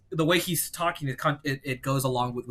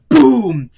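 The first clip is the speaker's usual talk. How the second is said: very loud, drawn-out boom; imitated boom; 8 semitones higher, 16 dB louder than usual. Telling friend about the title reveal, imitated boom. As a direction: slam it in like a trailer hit.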